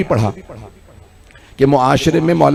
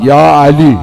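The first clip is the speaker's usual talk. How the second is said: low, strong call; 3 semitones lower, 5 dB louder than usual. Acted. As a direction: call the name out in a lowered, fuller voice. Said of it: "आपने आवाज दी".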